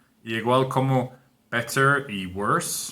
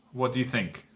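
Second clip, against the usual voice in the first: ordinary talk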